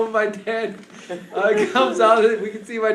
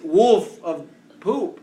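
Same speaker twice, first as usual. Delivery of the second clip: ordinary delivery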